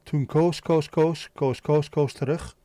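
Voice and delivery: rhythmic, repetitive Dutch patter; showing off easy Dutch; Dutch tongue twister. Shown as a demonstration. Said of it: "Dutch tongue, tongue twisters".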